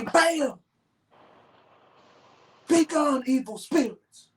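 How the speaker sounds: high falsetto exorcism cry; mock exorcist. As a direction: banish demons in a hammed-up high voice. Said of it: "take the cross out and say".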